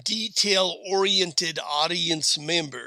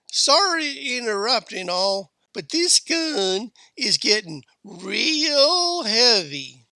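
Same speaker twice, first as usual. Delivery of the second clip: put-on kid's voice; 6 semitones higher, 3 dB louder than usual. A narrator's reading. Kid telling aunt the gun is heavy, high and plaintive. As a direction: pipe up high, dragging out the last words.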